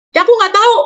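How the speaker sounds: put-on high sulky child voice; sulky teen. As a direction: whine it like a fed-up kid. Anak menjawab, suara anak. (anak cemberut dengan suara tinggi)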